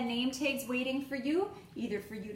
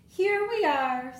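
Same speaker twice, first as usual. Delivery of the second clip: higher, louder, slow friendly announcement; cheery bus driver; 5 semitones higher, 7 dB louder, slower than usual. Acted. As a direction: announce the stop brightly. Bus driver voiced in story.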